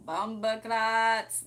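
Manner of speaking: loud, drawn-out Jamaican exclamation; big mock-Jamaican outburst; mock Jamaican accent. Exclaims Jamaican slang about Jamaican men.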